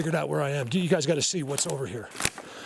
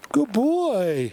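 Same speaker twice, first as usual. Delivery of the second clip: much higher, louder praise for an animal; pet voice; 9 semitones higher, 5 dB louder than usual. Real, not acted. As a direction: praise it like a dog doing a trick.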